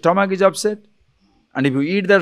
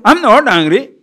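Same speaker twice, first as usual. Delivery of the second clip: higher and louder, heated denial; angry denial; 7 semitones higher, 6 dB louder than usual. Retold or imitated. Upset person denying anger to whoever asks, heated. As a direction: snap it back defensively. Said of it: "The person is so angry, so upset".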